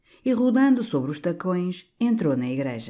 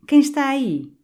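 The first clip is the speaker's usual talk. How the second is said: higher, louder mock call; quoting the canon; 10 semitones higher, 5 dB louder than usual. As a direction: mimic him calling out startled. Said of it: "Até o senhor me perguntou". (imitação do cônego, mais alta)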